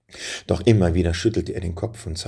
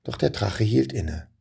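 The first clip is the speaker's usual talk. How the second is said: ordinary delivery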